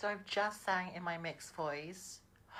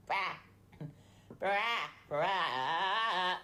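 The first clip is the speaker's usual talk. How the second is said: nasal voice; much slower than usual. Shown as a demonstration. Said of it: "make it really nasal".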